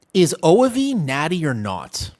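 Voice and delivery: a light stab at a high-voiced guy, drawn out; imitating Greg. Streamer tries out Greg's voice for chat, lightly imitated. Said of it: "I can't do the voice".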